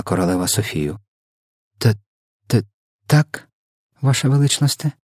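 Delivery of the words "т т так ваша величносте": high, halting, timid stammer; hesitant stuttered reply to royalty; stammering mumble. A narrator's reading. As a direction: stumble over the first sound, pitch raised. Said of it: "затинаючись".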